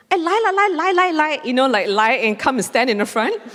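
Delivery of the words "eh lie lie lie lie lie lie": mimicking an auntie. Auntie urging her friends to cut in, high sing-song. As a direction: chirp it like a pushy auntie waving friends in. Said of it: "this person in front of me said".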